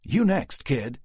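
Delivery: urgent order. A narrator's reading. Rick urging Chris into truck.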